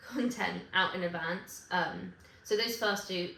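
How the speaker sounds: ordinary talk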